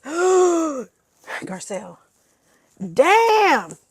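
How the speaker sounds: shocked drawn-out cry. Retold or imitated. The ladies react with shock.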